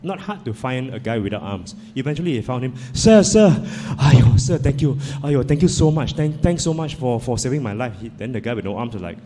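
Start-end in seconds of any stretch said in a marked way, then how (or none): 2.9-7.9 breathless, loud gushing gratitude; overjoyed cry of thank you; effusive thanks. Acted. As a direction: shout your thanks after him like he saved you. Grateful man chases down stranger.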